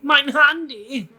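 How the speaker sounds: high, loud, drawn-out exclamation; mock German outburst. Joking with friend in German, mock high cry.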